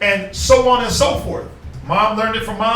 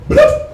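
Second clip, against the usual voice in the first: high-pitched bloop sound; 6 dB louder than usual. Retold or imitated. Imitating suction cup sound.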